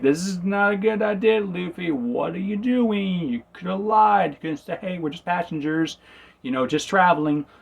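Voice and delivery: mock alarm. Room's horrified inner reaction.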